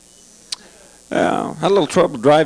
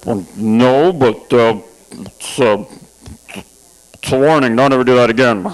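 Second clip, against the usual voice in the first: lower, hesitant shaken officer; rattled cop; 5 semitones lower, slower than usual. Retold or imitated. Cop hearing about blood in the trunk, low rattled warning. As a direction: cop backing off nervously.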